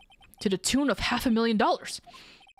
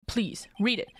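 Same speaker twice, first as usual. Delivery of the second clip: whisper. Real, not acted. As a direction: whisper it aside. Asking quietly.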